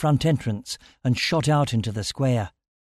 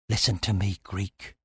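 hushed, grave warning; low warning; 4 semitones lower, 6 dB quieter than usual. A narrator's reading. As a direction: lean in and murmur. Frightened man warns quietly.

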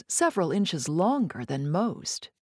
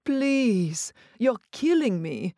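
higher, strained begging; tense pleading; 4 semitones higher, slower than usual. Acted. Jacques impatient for her verdict, tense pleading. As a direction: beg her to just taste it. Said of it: "tension filling his voice".

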